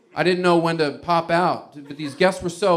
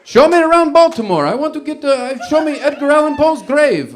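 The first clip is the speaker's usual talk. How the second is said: loud, high, badly faked Italian; hammed-up Italian accent, like Sesame Street's Count; 11 semitones higher, 10 dB louder than usual. Excited tourist demanding a grave visit, hammed-up Italian accent. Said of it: "sounds like the Count or something from Sesame Street".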